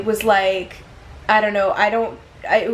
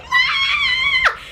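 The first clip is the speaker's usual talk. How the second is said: a piercing high scream, half laughing; high panicked scream. Retold or imitated. Owner grabbing her cat at the window, piercing high scream. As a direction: shriek high while clutching the cat. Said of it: "was holding her and screaming".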